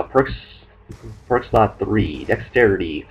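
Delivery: ordinary delivery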